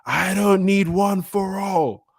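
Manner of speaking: hammed-up villain scream. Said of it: "you see Shigaraki screaming".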